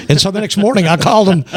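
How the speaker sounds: ordinary talk, nothing marked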